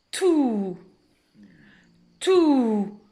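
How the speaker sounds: "tout" held as a long "touuu"; blended syllable. Shown as a demonstration. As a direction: join T to a drawn-out vowel in "tout". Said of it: "je vais lire". (« tout » lié, voyelle allongée)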